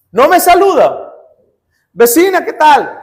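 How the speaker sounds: cheerful greeting. Acted. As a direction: greet her like nothing happened. Cheerful greeting to rude neighbour.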